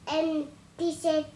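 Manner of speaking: ordinary delivery, nothing marked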